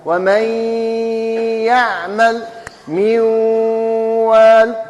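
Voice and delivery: ومن يعمل with a long held nasal hum; held nasal demo. Shown as a demonstration. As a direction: hold the ghunna long before the next word. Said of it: "كي تسمع الغنة". (غنة ممدودة طويلة)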